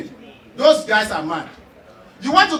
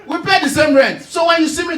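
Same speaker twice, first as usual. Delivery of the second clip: loud, high-pitched indignant comeback; hammed-up indignation, pitched up; indignant retort; 6 semitones higher, 4 dB louder than usual. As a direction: confront the snobbish neighbour, voice raised. Same retort repeated with force.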